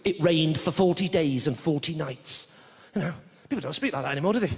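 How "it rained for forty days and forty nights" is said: slow, measured Bible-story delivery; scripture recitation. Acted. Reciting the Noah story to an audience, slow biblical reading. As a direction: recite it slowly like old scripture. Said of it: "like the Noah story".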